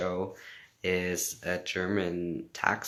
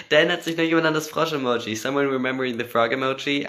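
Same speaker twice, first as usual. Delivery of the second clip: excited; 6 semitones higher, 8 dB louder, faster than usual. Real, not acted. Excited reading of fan comment.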